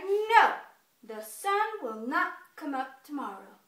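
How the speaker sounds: loud, emphatic bear declaration; insistent bear. Acted. Bear insisting to squirrel, loud firm denial. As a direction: insist with a booming no. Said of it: "the bear's like".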